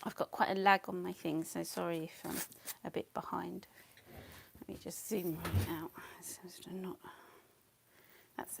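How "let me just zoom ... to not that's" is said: whispering. Real, not acted. Muttering while zooming.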